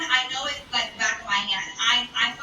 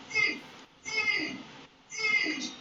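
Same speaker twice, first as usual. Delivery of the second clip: dismissive hum; 8 semitones higher, 5 dB quieter, much slower than usual. Retold or imitated. Dismissive sound.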